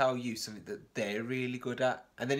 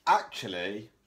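goose; 3 semitones lower, 7 dB louder, slower than usual. Acted. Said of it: "said the goose".

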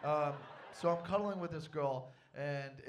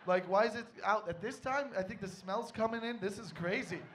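fake surprise; 5 semitones higher, 6 dB louder than usual. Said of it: "I was like".

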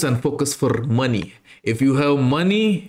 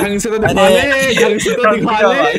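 fast, loud, higher mock shout; dubbed shout; 8 semitones higher, 7 dB louder, much faster than usual. Meme voice yells to show it properly, fast and loud. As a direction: bark it out fast as a meme dub.

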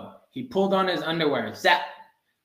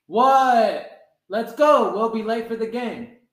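impatient call; 5 semitones higher, 5 dB louder, slower than usual. A narrator's reading. Impatient father hurrying Froggy.

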